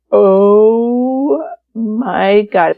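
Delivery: long, drawn-out stunned gasp of words; imitating people aghast, very slow; retold shocked reaction. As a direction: stretch it out slowly in mock horror.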